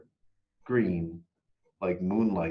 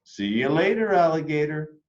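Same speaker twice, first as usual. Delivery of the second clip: higher, louder playful call; Daddy calling; 6 semitones higher, 5 dB louder than usual. A narrator's reading. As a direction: sing it down the hallway. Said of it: "Daddy called from the hallway".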